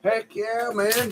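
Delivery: playful high-voiced heck yeah. Real, not acted. Repeats heck yeah, high far.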